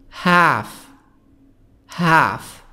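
American "Half" with the A of cat; long front AE in Half, American style; American accent. Shown as a demonstration. Teacher contrasting accents, American "Half" with front A. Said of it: "نطق البريطاني".

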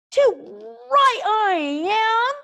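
high, slow, grumpy dragon voice. A narrator's reading. Toothless grumbling back at Hiccup's praise. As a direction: grumble it out, high and put-upon. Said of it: "said Toothless grumpily".

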